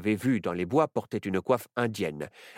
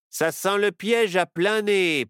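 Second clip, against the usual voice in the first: slow, higher, louder sneer; mocking sheriff; 9 semitones higher, 5 dB louder, slower than usual. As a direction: scoff at the obvious trap. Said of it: "persifla le shérif". (shérif qui persifle, lent et moqueur)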